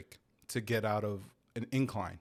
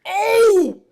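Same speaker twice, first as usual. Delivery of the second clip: loud, very high excited yell; excited shout; 17 dB louder than usual. Real, not acted. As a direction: blurt it out in excitement.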